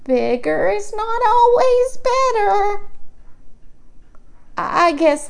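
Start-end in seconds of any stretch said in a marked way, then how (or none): 0.0-2.9 sing-song grandma saying. A narrator's reading.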